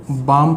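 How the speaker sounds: बाम with no l sound; silent l in balm. Shown as a demonstration. Teacher shows बाम with silent l. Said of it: "इसका जो pronunciation है वो होता है बाम".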